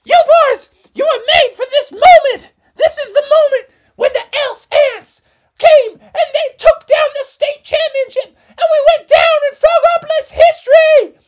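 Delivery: very high, loud tearful pep talk; fiery team mom. Acted. Missus Frogerson rallying the boys before the championship, shouting. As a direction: fire the boys up like it's the big game.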